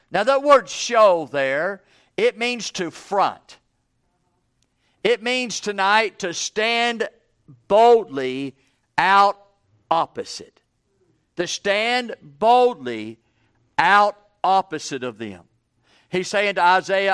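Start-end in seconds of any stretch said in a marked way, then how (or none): 5.0-15.4 "boldly" and "out" punched louder, slow; emphatic preaching. Acted. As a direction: lean hard on "boldly" and "out". Preacher stresses stand boldly.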